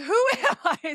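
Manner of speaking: high, giggling "who am I"; amazed laughing question; laughing disbelief. Retold or imitated. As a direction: laugh through it in happy shock. Disbelief at powerful car.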